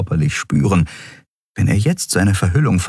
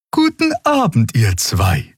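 high-pitched opening, falling back; Xea greeting; 6 semitones higher than usual. A narrator's reading. Character greeting two excited crewmates, high-voiced hello. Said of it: "grüßte Xea".

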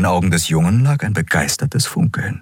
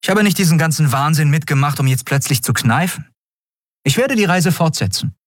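higher-pitched, resolute captain's voice; determined captain; 11 semitones higher than usual. Acted. Captain announcing she will continue the journey, determined. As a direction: declare it with resolve.

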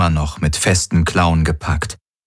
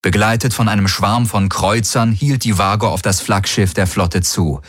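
ordinary delivery

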